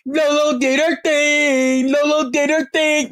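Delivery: high, drawn-out crowd cheers; mocking whoops of praise, held long; mock cheering. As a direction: imitate fans cheering her on. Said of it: "all of y'all was".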